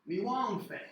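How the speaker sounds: Jamaican street patois, mi want fe. Shown as a demonstration. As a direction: drop into Jamaican creole. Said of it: "you hear people say".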